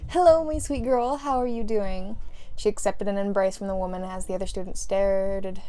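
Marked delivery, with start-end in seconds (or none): 0.1-2.2 high, loud, drawn-out gush; sweet doting greeting. A narrator's reading. Mother greeting her daughter, suddenly doting. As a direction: coo lovingly at your darling. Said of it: "her attitude turning a complete one eighty".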